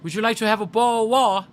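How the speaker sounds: lightly voiced British accent; mock British accent. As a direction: play a snooty Brit. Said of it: "Now do a Taya accent".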